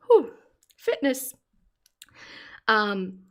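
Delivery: puffing out a breath; winded exhale; gasping for air. Real, not acted. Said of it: "I'm out of breath".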